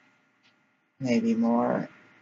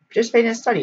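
high, louder mock advert voice; flyer read aloud; 10 semitones higher, 5 dB louder, much faster than usual. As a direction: pitch it up like a cheery notice. Reading out a flyer, acted.

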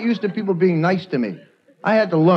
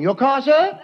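higher British valet voice; posh British valet; 7 semitones higher, slower than usual. Retold or imitated. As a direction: offer the car like a refined valet. English valet.

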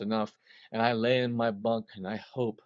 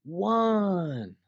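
loud, high call of one; a firm cued count; 6 semitones higher, 6 dB louder than usual. Retold or imitated. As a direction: mark the downbeat out loud. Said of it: "he goes like this".

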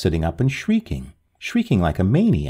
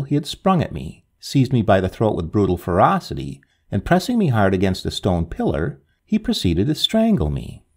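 ordinary delivery, nothing marked

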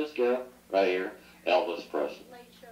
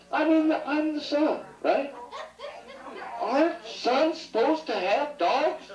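mock indignant complaint.

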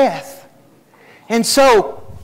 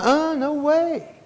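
stubborn refusal; 7 semitones higher, 5 dB quieter, slower than usual. Retold or imitated. Refusing wife's book idea.

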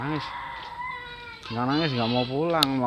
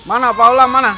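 loud high call for Paula; 10 semitones higher, 12 dB louder than usual. Real, not acted. Vlogger searching for Paula, loud calling. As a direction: shout her name to find her.

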